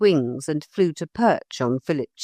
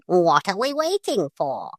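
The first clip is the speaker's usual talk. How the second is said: impatient parrot. A narrator's reading. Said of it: "said the bird impatiently".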